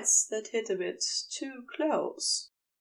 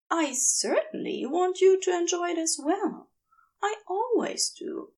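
a raised, hopeful angel voice; earnest angel; 8 semitones higher than usual. Acted. Angel insisting his lover enjoy it too, hopeful. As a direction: insist on it gently, hoping.

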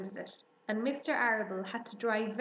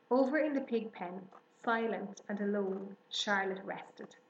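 plain reading voice

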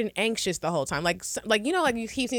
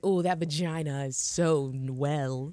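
low, slow mock-sultry line; mock dirty talk; 7 semitones lower, slower than usual. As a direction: purr it slowly and low, hamming it up.

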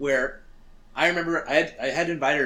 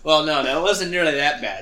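laughing denial; 7 dB louder than usual. Real, not acted. Still laughing at joke.

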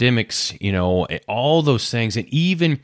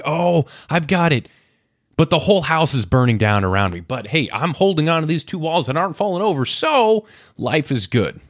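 higher, breezy "everything's fine" voice; mock cheerful denial; 6 semitones higher than usual. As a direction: play it like someone pretending the fire isn't there. Mocking self in burning house.

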